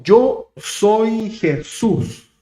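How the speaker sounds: stately, stretched-out divine announcement; voice of Jesus. Acted. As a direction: reveal who you are, word by word. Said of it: "Y le dijo".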